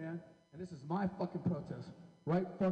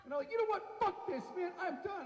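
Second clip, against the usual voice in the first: high-pitched fed-up outburst; 10 semitones higher than usual. Acted. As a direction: quit in high-pitched exasperation. Acted quitting after getting jumped.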